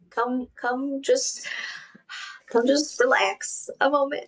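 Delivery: lightly acted, pressing coax. Friend urging her stressed friends to unwind, coaxing.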